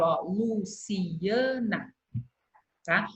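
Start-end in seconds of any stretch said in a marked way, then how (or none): 0.2-1.9 Luciana very slowly, broken into lu-ci-a-na; separated syllables. Shown as a demonstration. Teacher explaining why strong vowels separate, syllable by syllable. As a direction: split the I from the A in Luciana. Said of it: "Por isso que eu separo".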